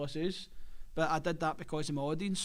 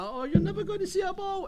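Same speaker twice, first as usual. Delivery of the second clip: much higher, put-on movie character voice; film fight-scene impression; 14 semitones higher, 4 dB louder than usual. Comedian quoting a film fight scene to his hosts, high-pitched mimicry. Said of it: "See the fight scene? When he's like".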